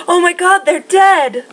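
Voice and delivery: high, loud horrified outcry; shocked cry. Retold or imitated. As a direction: cry out like you just found them dead. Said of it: "and they go".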